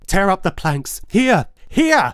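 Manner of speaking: loud, high, desperate shouting; frantic screaming confession. Acted. Killer confessing to the officers, screaming. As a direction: scream the confession, all control gone. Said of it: "I shrieked".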